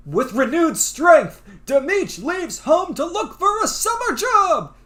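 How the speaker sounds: slow, booming story narration; dramatic narrator. Acted. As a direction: announce it like an epic narrator. Reads game narration.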